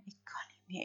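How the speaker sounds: whispered, all breath gone after crying; broken whisper. A narrator's reading. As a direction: barely breathe the words out. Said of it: "begint Freek te huilen".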